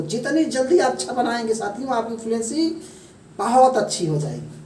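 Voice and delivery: ordinary delivery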